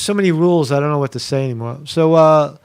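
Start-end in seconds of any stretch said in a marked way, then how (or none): none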